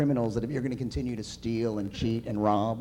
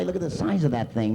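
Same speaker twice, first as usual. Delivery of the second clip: ordinary talk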